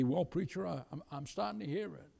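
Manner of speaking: very soft, halting admission; hushed and faltering; hesitant reply. Acted. Listener admitting to preacher, hesitant and hushed.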